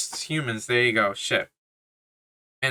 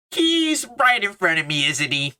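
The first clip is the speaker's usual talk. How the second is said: high, louder mock of a corny movie line; mocking quote; 6 semitones higher, 4 dB louder than usual. Reviewer mocking a Marvel joke line, in a high, louder, sarcastic voice. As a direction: sneer at the corny joke by parroting it. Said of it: "They crack a joke like".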